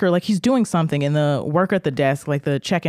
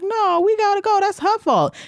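put-on careless girls. Girls telling the guards they are leaving their friend, high and breezy.

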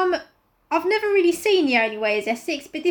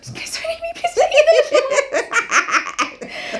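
high mock-terrified begging; hammed-up pleading; 9 semitones higher, much slower than usual. Imagining Ralph praying to the beast, high pleading. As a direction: beg in a high comic panic.

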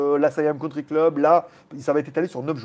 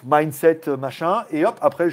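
normal talking voice

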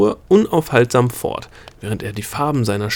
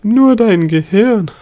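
"Nur" hit high and loud, slow mocking question; stress on nur; 11 semitones higher, 9 dB louder, slower than usual. A narrator's reading. Gnome mocking the princess, stressing "Nur" loudly. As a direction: lean hard on "Nur", higher and louder, drawn out. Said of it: "seltsamen Betonung auf nur".

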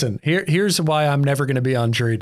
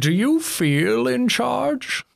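slow, much higher, drawn-out Bane voice; Bane impression; 12 semitones higher, much slower than usual. Doing a Bane quote for the podcast co-hosts, slow Bane voice. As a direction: stretch each word like the masked villain. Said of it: "now I wanna do more Bane quotes".

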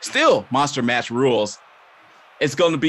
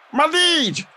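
panicked guard cry; 14 semitones higher than usual. Acted. Guard cries out comically.

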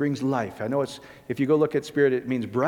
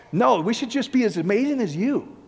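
mocking; 6 semitones higher than usual. Mocking a legalistic believer.

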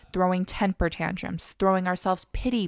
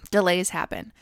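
ordinary talk, nothing marked

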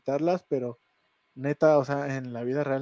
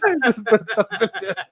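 high, loud, cracking up on the words; laughing mock title; 9 semitones higher, 10 dB louder than usual. Real, not acted. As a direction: repeat the teasing title through real laughter. Repeats teasing horror title, laughing.

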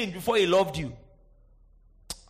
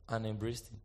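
much softer, lower, hushed; tender; 12 semitones lower, 12 dB quieter than usual. Acted. Preacher softly telling of the father's embrace. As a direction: let it fall to a hush.

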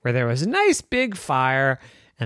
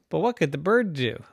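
ordinary delivery, nothing marked

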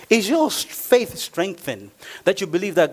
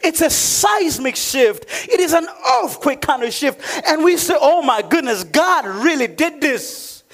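raised, emphatic sermon delivery; preaching; 8 semitones higher than usual. Real, not acted. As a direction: preach it out, stretching the key words.

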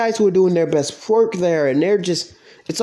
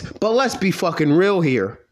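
usual speaking voice

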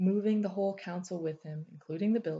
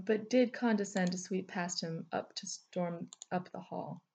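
plain reading voice

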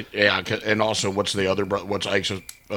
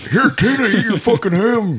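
mock director yell; 13 semitones higher, 9 dB louder than usual. Director forcing ham on the horse, high loud mock shout. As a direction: shriek it like a crazed director feeding a horse.

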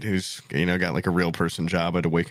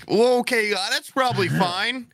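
mock self-reassurance; 11 semitones higher than usual. Retold uneasy self-reassurance after swallowing.

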